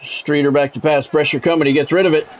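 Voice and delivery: measured broadcast call of the down and the drive; sports play-by-play. Acted. Streamer calling his video game to chat, play-by-play announcer voice.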